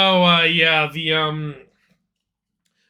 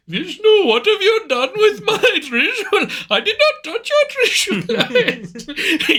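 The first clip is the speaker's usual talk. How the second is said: much higher, laughing put-on Indian accent; squeaky hammed-up Indian accent as an angry god; mock Indian accent; 3 dB louder, slower than usual. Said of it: "Shiva coming in like".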